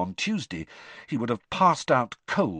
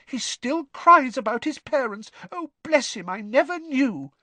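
high, fretting mum voice, faster at the end; mother's anxious voice; 5 dB louder than usual. Retold or imitated. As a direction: son mimicking his worried mother. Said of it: "Charlie imitated his mother's anxious voice".

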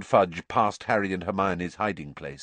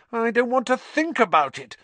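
high, louder anxious moan; 13 semitones higher, 4 dB louder than usual. A narrator's reading. Hermione running, moaning in dread. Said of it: "Hermione moaned".

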